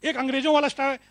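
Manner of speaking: ordinary talk, nothing marked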